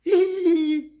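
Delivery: very high, loud "hi hi" giggle; shrieking giggle. A narrator's reading. Carved mouth taunting its maker, high giggle. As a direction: squeal with mocking laughter. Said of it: "lachte der Mund".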